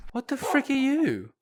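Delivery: slow baffled question. Real, not acted. Seeing Dennis.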